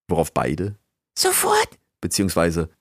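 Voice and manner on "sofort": very high, tiny squeaky voice; eager rat squeak. A narrator's reading. Rat assistant answers the master's order, eager squeak. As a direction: pipe up as a little rat. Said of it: "riefen und sich umgehend in Bewegung".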